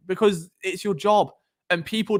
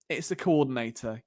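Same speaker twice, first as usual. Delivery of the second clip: ordinary delivery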